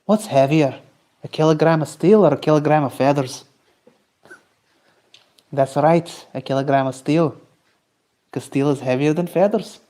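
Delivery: imitated Scottish quizmaster logic; mock-earnest Scottish accent; Scottish meme accent. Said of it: "do a Scottish accent".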